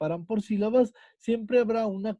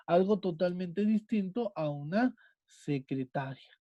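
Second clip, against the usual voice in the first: ordinary delivery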